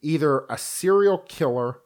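ordinary talk

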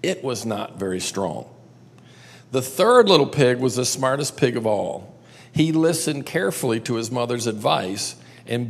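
lively read-aloud for a group, lingering on the big bad wolf; storytelling narration. A narrator's reading. Teacher reading the three little pigs to the class, lively storytelling. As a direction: tell it like a bedtime tale to a room of kids.

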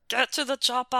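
half-hearted Austrian action-hero impression; Arnold. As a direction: try the Austrian strongman voice, not quite managing it. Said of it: "do it in the Arnold voice".

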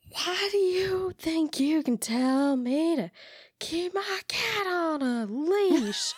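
put-on very high, slow drunk indignation; outraged drunk, stretched out; drunk person voice. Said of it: "a little bit like Boston".

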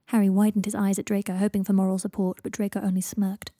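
ordinary delivery

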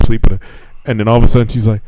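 ordinary delivery

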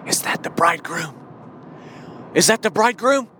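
lightly voiced virgin, peering into the dark, rising on the second ask; a hint of an anxious bride-to-be calling out; hopeful searching virgin. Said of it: "And she's saying".

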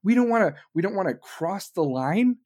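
ordinary delivery

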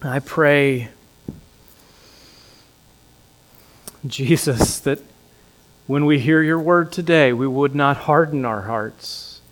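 soft, hushed prayer. Acted. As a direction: pray quietly to God. Closing prayer.

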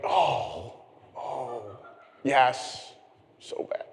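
hushed, sinking embarrassment, retold; mortified. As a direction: shrink away softly as if caught being rude. Retelling his embarrassment on the call.

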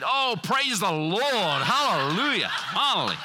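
high, slow hammed-up praise; mock rejoicing. Preacher hamming up his joyful reply to cousin's call. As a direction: drag out the praise, mock delight.

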